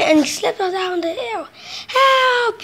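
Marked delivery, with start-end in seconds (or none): 1.9-2.6 high, frightened cry of help; cried for help. A narrator's reading. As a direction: call out in a panic, pitched up. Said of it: "he cried".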